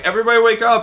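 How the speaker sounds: rousing the bay with a call. Retold or imitated. Recruit waking the whole bay, calling out. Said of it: "and I'm like".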